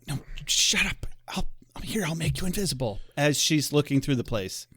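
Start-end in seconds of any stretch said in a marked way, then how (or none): 0.1-3.0 hushed, hurried stage whisper; acted whispers. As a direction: hiss it secretly so nobody nearby hears. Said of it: "whispers where they're like".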